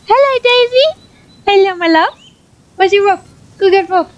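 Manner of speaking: high, loud doggy greeting; pet talk. Real, not acted. Owner greeting her dog Daisy, high excited pet talk. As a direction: gush over the dog like a puppy parent.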